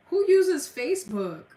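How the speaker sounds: who even uses that, really; incredulous. Real, not acted. Streamer scoffs at a viewer's Facebook mention, incredulous.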